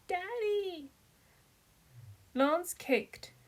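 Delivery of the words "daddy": much higher crying call for her dad; a small girl being carried off; wailing child. A narrator's reading. Child taken away from father.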